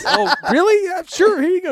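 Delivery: high, loud acted relief; mock delighted surprise. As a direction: leap on the offer, high-pitched.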